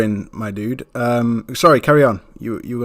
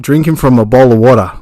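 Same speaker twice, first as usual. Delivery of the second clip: louder, hammed-up Aussie twang; mock Australian accent; 5 dB louder than usual. Host strings Aussie words together, in a mock Australian accent. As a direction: put on a broad Australian accent. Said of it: "in Australian".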